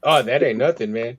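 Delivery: loud scoff. Real, not acted. Scoffs at seventy dollars.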